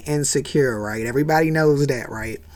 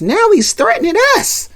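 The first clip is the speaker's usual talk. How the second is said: put-on tattling little girl; 3 dB louder than usual. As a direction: tell on him to mom, whining. [name] tattling high.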